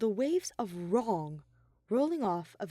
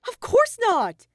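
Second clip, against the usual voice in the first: high, loud, slow protest; exclaimed; 12 dB louder, slower than usual. A narrator's reading. Shuichi protesting to Monokuma, loud and high. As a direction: cry it out in protest. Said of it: "he exclaimed".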